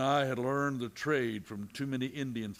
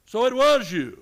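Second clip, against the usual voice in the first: much higher and louder, caught off guard; startled realisation; 10 semitones higher, 12 dB louder than usual. Acted. Matt finds Narisha was the shooter, startled cry. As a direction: blurt it out in shock.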